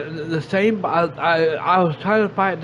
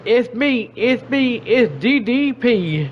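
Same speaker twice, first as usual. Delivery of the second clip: higher, teasing grand reveal; playful mock reveal; 5 semitones higher than usual. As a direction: ham up a big entrance reveal, a touch higher. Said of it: "it would have been funny if he sit there and said".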